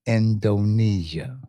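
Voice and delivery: slow, broken into syllables; sounding out words. Shown as a demonstration. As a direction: spell it out syllable by syllable. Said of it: "say the word slow and break it into syllables".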